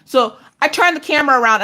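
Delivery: normal talking voice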